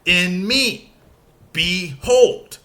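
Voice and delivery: "me" and "hold" hit, pauses between; iambic stress. Shown as a demonstration. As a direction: scan it da-DUM. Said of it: "Unstressed followed by stressed".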